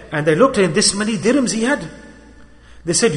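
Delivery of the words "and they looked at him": ordinary delivery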